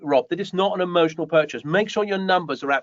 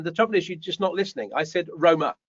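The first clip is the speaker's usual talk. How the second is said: normal talking voice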